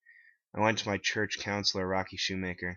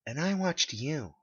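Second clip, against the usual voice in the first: Megan's higher, slow comeback; higher, slow calm retort; 8 semitones higher, slower than usual. A narrator's reading. Megan answering the narrator's accusation, higher and slow. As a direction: turn it back on him coolly. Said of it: "she replied".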